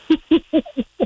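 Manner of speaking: bright high burst of laughter; a quick delighted laugh up high. Real, not acted. Host laughing at the caller's birthday wish, high and delighted.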